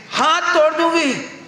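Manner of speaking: high, slow mock threat from an angry mother; mother's threat. (ऊँची, धीमी आवाज़ में माँ की बनावटी धमकी)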